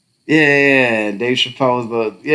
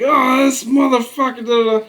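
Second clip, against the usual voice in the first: higher, louder mock anger; riled up; 12 semitones higher, 4 dB louder than usual. Mimicking someone taking the bait, angry. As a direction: act out getting riled. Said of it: "You're like".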